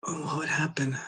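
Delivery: breathy whisper to herself; whispered aside. Real, not acted. Whispering to herself while recalling the story. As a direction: drop to a whisper while trying to remember. Thinking aloud mid-story.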